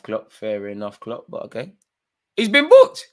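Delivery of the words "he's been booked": high, loud indignant yell; outraged shout. Real, not acted. Streamer yelling at the referee over a yellow card, high and loud. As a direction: explode at the referee's decision.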